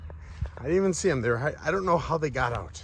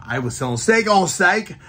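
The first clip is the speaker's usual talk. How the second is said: ordinary talk, nothing marked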